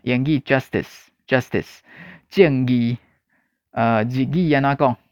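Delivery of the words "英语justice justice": justice said slowly and clearly, twice; teacher spells out justice in slow careful English; English word demo. Shown as a demonstration. Teacher modelling English justice for Taiwanese learners, slow and clear.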